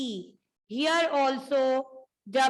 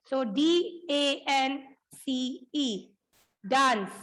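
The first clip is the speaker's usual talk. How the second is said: letter by letter for the class; spelled out letters; slower than usual. Shown as a demonstration. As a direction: spell each letter slowly, then say the word. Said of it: "spelling aloud for class". (अक्षर-अक्षर बोलकर)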